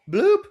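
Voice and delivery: squeaky high bloop; high bloop sound. Pretend. Sound effect after joke.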